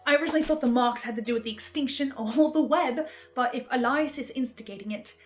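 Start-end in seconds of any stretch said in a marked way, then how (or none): none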